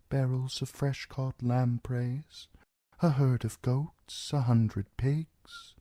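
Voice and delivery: a pale lord speaking quietly and coolly; soft, detached nobleman; cold Lord Bolton. Acted. Narrator voices Roose Bolton.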